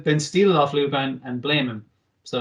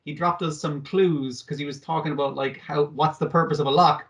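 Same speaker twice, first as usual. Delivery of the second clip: ordinary delivery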